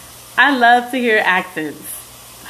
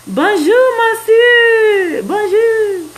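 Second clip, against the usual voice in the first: high, loud hammed-up French accent; mock French greeting; 12 semitones higher, 5 dB louder, much slower than usual. Joking about learning French on a livestream, hammed-up French accent. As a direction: sing out a playful French hello, pitched way up. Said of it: "Wanna learn French?".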